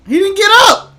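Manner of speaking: high, loud exclamation; excited shout. Real, not acted. Fan watching the count end, excited shout. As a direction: cry out at the knockdown. Calling out during a wrestling match.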